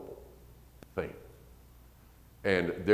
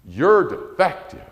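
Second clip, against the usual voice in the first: message spelled out; 9 semitones higher, 9 dB louder, slower than usual. Retold or imitated. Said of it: "what messages are being conveyed".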